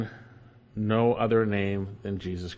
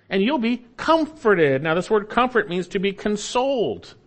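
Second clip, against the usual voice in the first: full-force preaching; 6 semitones higher than usual. Real, not acted. Preacher pressing judgment on congregation, fervent climax.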